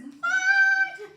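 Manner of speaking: a high, loud shriek of disbelief; shocked shriek. Retold or imitated. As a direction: scream it out in total shock. Husband says he's divorcing her.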